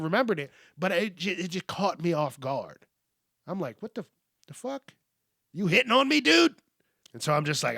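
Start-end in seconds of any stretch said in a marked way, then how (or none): 3.8-6.5 shocked disbelief. Retold or imitated. Shocked at stranger's introduction.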